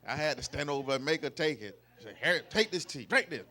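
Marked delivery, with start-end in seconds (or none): 2.2-3.4 louder, pressing command; firm but playful urging; retold insistent order. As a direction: push the drink on someone. Retold insisting she drink tea.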